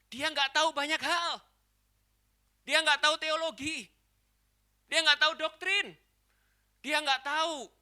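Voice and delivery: high, loud emphatic declarations; full-force preaching. Real, not acted. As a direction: cry out each phrase to the congregation.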